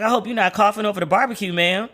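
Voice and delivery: high, quick mock-scolding of a friend. To a friend coughing while barbecuing, mock scolding.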